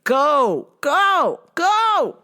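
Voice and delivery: high commands climbing in pitch; boss's mock yelling. Boss sending the woman away, mock yelling. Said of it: "My mans was yelling".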